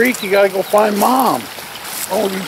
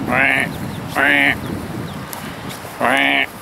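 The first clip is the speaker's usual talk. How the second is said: mock quacking to call the duckling; imitating a duck call for the lost baby; duck quack imitation; 5 semitones lower, 5 dB quieter, much slower than usual. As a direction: quack like a mother duck calling.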